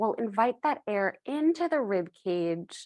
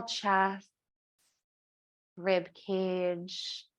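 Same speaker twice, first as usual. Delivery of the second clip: slow, spaced-out breath cues; guided breathing. Acted. Yoga teacher leading the three-part breath, measured guided breathing voice. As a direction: count them through the breath, leaving long pauses.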